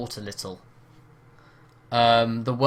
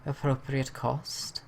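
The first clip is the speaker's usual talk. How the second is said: put-on Welsh accent; 5 dB quieter than usual. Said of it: "really bad Welsh accent".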